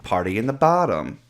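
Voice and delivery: normal talking voice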